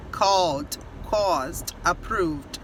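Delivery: CALLED, CAUSED, APPROVED low, no extra syllable; final -ed as d. Shown as a demonstration. Teacher recapping the rule, called, caused, approved softly with only final d. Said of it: "como se fosse só o D".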